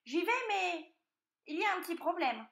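high, eager piglet voice; stalling piglet. A narrator's reading. Piglet stalling before the roasting dish, high pleading. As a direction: raise an objection in a high piglet voice.

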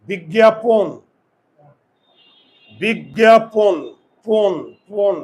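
"বিজ্ঞাপন" loud, ending in a rounded pon; closed-jaw o. Shown as a demonstration. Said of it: "চোয়াল খুলে না".

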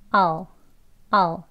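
"아오" cut very short, nearly "al"; brief, reduced I'll as "아오"; shorter reduction. Shown as a demonstration. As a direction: squeeze "아오" into one quick syllable. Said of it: "이제 더 짧게 발음을 하면".

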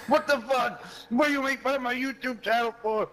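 high mock outrage, imitating Jay. Friend mimics Jay whining about his channel, mock indignant. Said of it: "And then he'll be like".

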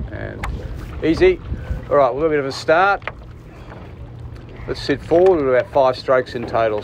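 usual speaking voice

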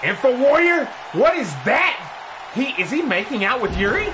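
brisk ringside call, rising with the action; play-by-play commentary. Acted. Commentator calling the match for viewers, energetic play-by-play. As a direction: call the match like a wrestling announcer.

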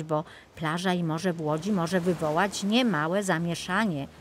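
usual speaking voice

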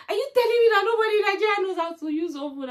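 high, loud incredulous jeer; mocking colleague. Coworker asking if nobody in Nigeria knows ovens, high loud jeer. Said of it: "It's like".

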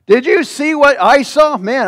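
ordinary talk

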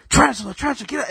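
high-pitched, panicky mock scream; mock yell. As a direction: act out a spider freak-out without full volume. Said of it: "I was, like, yelling at Chris".

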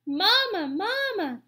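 put-on high, loud baby-bird cry; peeping gosling. A narrator's reading. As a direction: peep it like a hungry hatchling. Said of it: "peeped the gosling".

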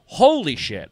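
high burst of mock shock; retold stunned reply to the heckler. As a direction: react as if floored. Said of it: "And I'm like".

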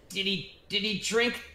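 high, puzzled goblin voice; a goblin suddenly working it out aloud. Acted. Confused goblin asking the party, high and wondering. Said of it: "and he said".

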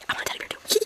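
whispered aside; whispering. Real, not acted. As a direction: breathe it like a secret. Quiet aside after excitement.

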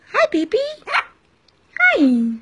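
high cooing hello; pet talk. Real, not acted. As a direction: coo it up high to the bird. Greeting her bird.

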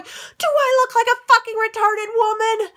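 furious shriek, high and loud; inner scream. Retold or imitated. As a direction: scream it at the top of your lungs. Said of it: "screaming at the top of my lungs".

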